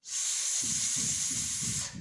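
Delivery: «С» as a long voiceless hiss. Shown as a demonstration. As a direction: blow out a soft hissing «С». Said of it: "А ну, давайте спробуємо". (тривалий глухий звук «С»)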